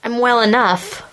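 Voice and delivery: forced out through clenched teeth. Acted. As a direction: push it past gritted teeth. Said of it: "Lena says through clenched teeth".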